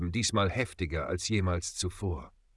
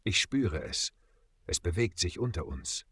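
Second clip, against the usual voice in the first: leiser, nur angedeutet geflüstert; gedämpft und unheilvoll, halb geflüstert; angedeutetes Flüstern; 3 semitones lower, 5 dB quieter than usual. A narrator's reading. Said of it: "flüsterte er". (leise, beinahe flüsternde Warnung)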